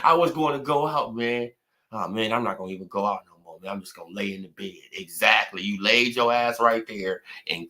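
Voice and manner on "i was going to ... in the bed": much softer and fast, a limp mocking mumble; mocking defeated mumble. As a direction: mimic a beaten man giving up, quiet and rushed. Mocking Fanon's defeated words.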